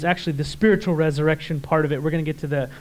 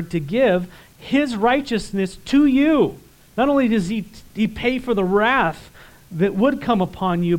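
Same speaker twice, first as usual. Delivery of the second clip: raised, driven sermon voice; fervent preaching; 3 semitones higher, 4 dB louder than usual. Acted. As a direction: preach it out with fire. Preaching at full force.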